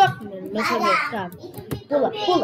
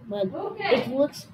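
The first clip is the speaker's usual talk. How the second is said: usual speaking voice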